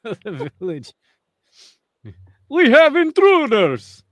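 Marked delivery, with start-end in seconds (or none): 2.5-4.0 high, loud, drawn-out mock cry; lookout's alarm. Village lookout announcing intruders, loud mock alarm. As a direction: call out slowly and loudly, hammed-up. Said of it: "he's the lookout of the".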